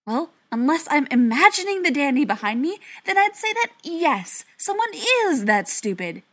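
high, loud, exasperated sarcasm; heated retort. A narrator's reading. Ryan on the phone about the dandy behind him, exasperated. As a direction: argue back sharply, pitch jumping up, slowing at the end.

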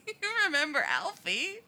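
high, loud, laughing through it; wheezing laugh. A narrator's reading. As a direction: squeeze it out through giggles. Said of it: "the voice wheezes".

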